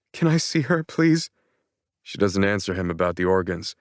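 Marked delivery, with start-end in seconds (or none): 0.1-1.3 pleading. A narrator's reading. Pleads to see mother.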